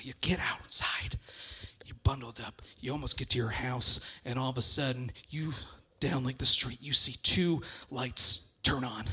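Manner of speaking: hushed, creeping storyteller whisper; spooky hush. Acted. Comic telling the crowd a creepy story, hushed. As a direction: drop to a spooky hush to build dread. Spooky ice cream truck build-up.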